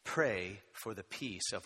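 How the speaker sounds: drawn-out "Pray for the peace of", each word stretched slowly and left hanging; preacher slows right down so the congregation finishes the verse; slow prompt to fill in. Shown as a demonstration. Preacher prompting the congregation to finish the verse, slowly drawn out.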